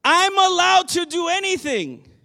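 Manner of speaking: loud, high defiant claim. Retold or imitated. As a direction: shout it out like a defiant crowd. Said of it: "their response is".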